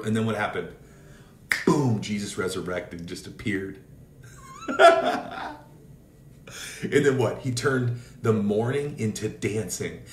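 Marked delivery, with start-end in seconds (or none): 4.5-5.6 a burst of very high, loud laughter; one loud, high joyful laugh; sudden laugh. Real, not acted. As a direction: laugh out with joy.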